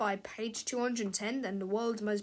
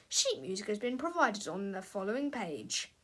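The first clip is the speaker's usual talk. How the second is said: usual speaking voice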